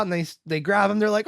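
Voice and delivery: ordinary talk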